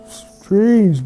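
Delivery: ordinary delivery, nothing marked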